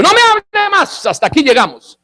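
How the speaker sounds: high, sudden cry to stop; hammed-up, high-pitched protest; mock outraged refusal. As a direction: cut the speaker off in acted alarm. [name] abruptly refuses to listen.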